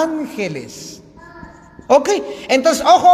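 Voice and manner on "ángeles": "ángeles" said slowly, stress on the first a, ÁN-ge-les; ángeles with accent on the a. Shown as a demonstration. Preacher spelling out the word for the congregation, slow and stressed. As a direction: stress the written tilde on "ángeles". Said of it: "con tilde aquí en la A".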